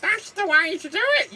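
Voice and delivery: high, loud squawking puppet; Mr Punch voice. Retold or imitated. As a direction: screech it like Mr Punch. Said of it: "where you get Mister Punch".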